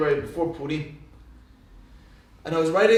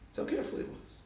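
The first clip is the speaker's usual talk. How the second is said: whispered echo; 14 dB quieter, faster than usual. Shown as a demonstration. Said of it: "repeated phrase".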